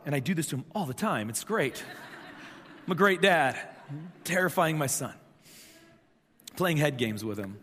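normal talking voice

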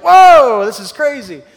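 sudden high loud whoa, then settling; amazed whoa. Retold or imitated. Moses gasping in awe as his stick becomes a snake. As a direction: gasp out the wonder at the snake.

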